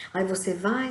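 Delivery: usual speaking voice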